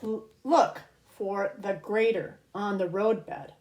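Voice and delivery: lilting read-aloud storybook voice; reading to children. Acted. Host reads a rhyming book to kids, storybook lilt. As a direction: read each rhyme slowly to little ones.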